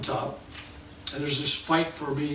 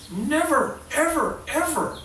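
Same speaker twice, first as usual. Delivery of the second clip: "never, ever, ever" each higher and falling, pleading; stress on ever; 12 semitones higher, 4 dB louder, slower than usual. Retold or imitated. Healer stresses "ever" in a mock plea. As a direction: draw out each "ever" as a wail. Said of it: "Did I ever say".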